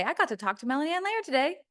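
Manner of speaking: light put-on bragging, sped up; imitating a name-dropper. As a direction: quote a proud guest, quick rising lilt. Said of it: "the person who gets to say".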